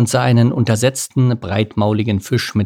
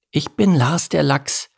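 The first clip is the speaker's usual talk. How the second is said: small salmon voice; 6 semitones higher than usual. Acted. Voices Lars.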